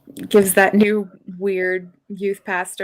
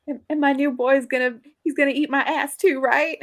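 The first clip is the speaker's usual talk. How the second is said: higher-pitched, breezy mock dismissal; lightly acted, higher Judy voice; imitating Judy, brushing it off; 6 semitones higher than usual. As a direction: quote her as if laughing it off. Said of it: "like, laughing it off".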